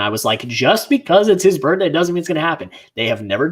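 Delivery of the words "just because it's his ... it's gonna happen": exasperated rant. Retold or imitated. Friend retelling his rant from the drive, exasperated. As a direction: drive the point home in one run.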